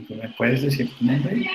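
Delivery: ordinary talk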